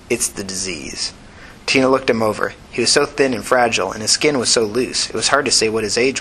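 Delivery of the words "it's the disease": soft, slow DISEASE with a falling close; weary admission. A narrator's reading. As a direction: confess it slowly and very quietly. Sick man explains his failing memory.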